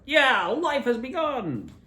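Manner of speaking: high, slow, loud cheer; slow, high elated cheer. Retold or imitated. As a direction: savour the triumph. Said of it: "we're like".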